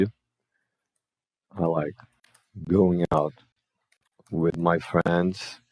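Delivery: I like, going out, with my friends, each chunk said very slowly with pauses between; chunked slow demo. Shown as a demonstration. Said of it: "Ó, então a gente vem aqui". (devagar, em blocos separados, mais baixo)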